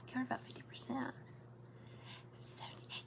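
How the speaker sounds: whispered reading of comments; whispering. Real, not acted. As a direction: murmur it under your breath.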